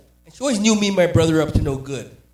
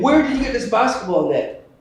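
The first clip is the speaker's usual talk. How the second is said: higher, louder suspicious question; mock parental interrogation; 6 semitones higher, 5 dB louder than usual. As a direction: confront the kid, half outraged. Father confronts son over stolen net.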